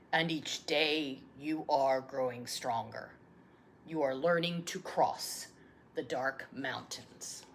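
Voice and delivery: plain reading voice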